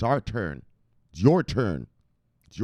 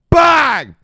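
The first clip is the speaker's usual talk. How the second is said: very high, very loud "bang"; explosive shout; 16 dB louder than usual. Real, not acted. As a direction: fire it off like a cannon. Punctuates his hype rant.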